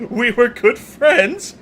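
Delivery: slow, high, laughing through the words; mock his claim of friendship while still laughing; laughing disbelief. Throwing the chief's words back at him, slow, laughing and high.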